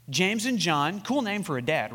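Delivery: ordinary delivery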